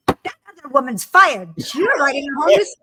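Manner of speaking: loud, animated imitation of the producer; emphatic boss's decree. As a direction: re-enact an excited boss announcing it on the spot. Acting out producer's snap decision.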